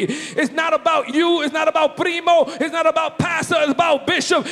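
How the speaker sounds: loud, fervent preaching over music; full-force preaching. Real, not acted. Preacher shouting praise to the congregation, fervent.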